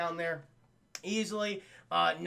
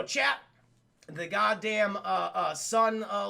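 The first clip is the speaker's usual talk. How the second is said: usual speaking voice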